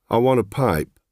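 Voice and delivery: flat, even request with no interest; flat customer's request; said flatly. A narrator's reading. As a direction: ask for it like a stranger, no feeling. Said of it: "I said flatly".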